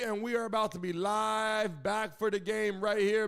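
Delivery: ordinary talk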